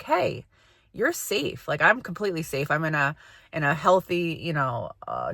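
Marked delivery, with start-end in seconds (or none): 0.0-1.7 soothing reassurance. Pretend. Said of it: "And now it's like".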